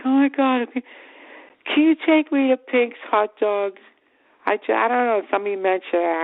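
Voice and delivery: put-on high, drawn-out pleading like a little kid; little kid act. Girlfriend begging him for hot dogs, little-kid pleading. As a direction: wheedle in a high, cutesy girlfriend voice. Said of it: "act like they're like a little kid".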